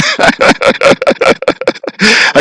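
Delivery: breathy, silent giggle; laughing at own joke. Real, not acted. Streamer laughs at his own genie joke, breathy giggle. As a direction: laugh off the bad punchline.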